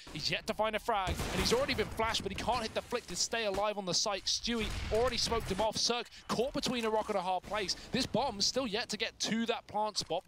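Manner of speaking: fast, high, loud esports call; excited play-by-play. Acted. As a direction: call the action like a live caster.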